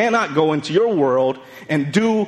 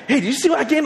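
voicing his wife; 9 semitones higher, faster than usual. Wife's voice retold.

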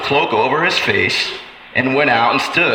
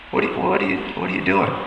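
breathless whisper, disappointed; God whispers to the one who relapsed; whispered question; 8 semitones lower, 6 dB quieter, much faster than usual. Acted. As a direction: whisper it like a heartbroken friend. God asking the listener.

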